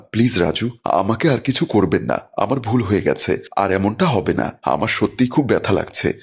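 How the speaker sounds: ordinary delivery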